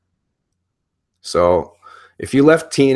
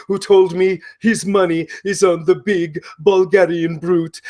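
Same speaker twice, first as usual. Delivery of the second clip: Broken Matt Hardy impression; 4 semitones higher than usual. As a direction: play the unhinged, grandiose wrestler announcing matches. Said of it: "the very complex character of Broken Matt Hardy".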